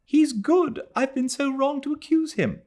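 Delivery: put-on a girl clinging and begging; sobbing plea. A narrator's reading. Adela voice continues.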